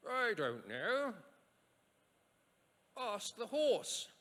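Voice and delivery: high, loud, drawn-out king; hammed-up king, stretching every word; king's reply. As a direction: call it back slowly in a put-on royal voice. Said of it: "To which the king replies".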